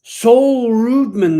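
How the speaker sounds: loud, slow shout-out of a name; calling out. Acted. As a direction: hail the viewer cheerfully.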